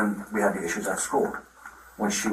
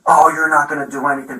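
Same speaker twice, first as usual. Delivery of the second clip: louder, higher mocking imitation; mimicked defensiveness; 6 semitones higher, 8 dB louder than usual. Dad retelling his daughter cursing back at him, mimicked defensively. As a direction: throw it back like an upset kid's complaint. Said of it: "getting all defensive".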